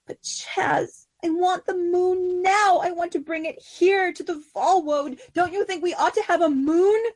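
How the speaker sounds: high, whining plea; a spoiled queen begging for the moon; pleading Sisera. Acted. Sisera begging her wife for the moon, high and pleading.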